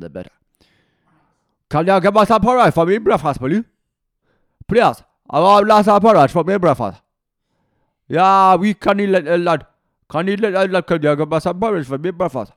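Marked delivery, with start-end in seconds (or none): 1.7-12.5 high, shouted, garbled Geordie accent; indecipherable Geordie accent. Shown as a demonstration. Coach demonstrates asking for breakfast porridge, indecipherable Geordie accent. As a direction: bellow it in barely decipherable Geordie. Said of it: "The, the more indecipherable you can make it sound".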